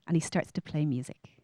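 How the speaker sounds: normal talking voice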